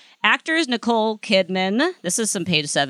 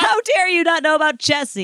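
high-pitched indignant yell, played up; mock outrage; 10 semitones higher than usual. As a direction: feign scandalized fury, pitch shooting up.

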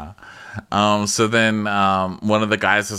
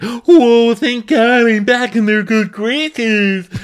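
high, loud and slow put-on voice; hammed-up relieved chef; mock chef relief; 14 semitones higher, 8 dB louder, much slower than usual. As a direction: gasp in exaggerated relief. Imitating Matt relieved.

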